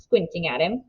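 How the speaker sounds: usual speaking voice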